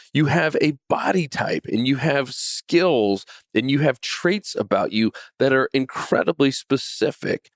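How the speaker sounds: normal talking voice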